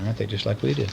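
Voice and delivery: ordinary delivery, nothing marked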